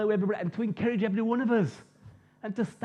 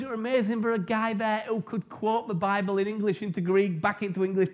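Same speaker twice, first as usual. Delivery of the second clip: ordinary talk, nothing marked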